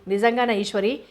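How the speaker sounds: ordinary delivery, nothing marked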